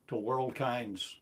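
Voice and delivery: normal talking voice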